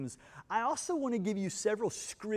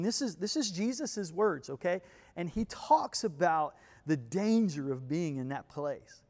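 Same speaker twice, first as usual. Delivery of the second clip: usual speaking voice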